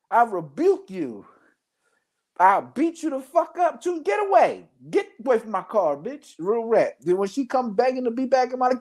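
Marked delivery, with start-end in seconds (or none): 2.4-6.4 high-pitched, hammed-up angry warning; angry threat. Driving an ex away from the car, high-pitched mock threat. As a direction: chase them off in mock outrage.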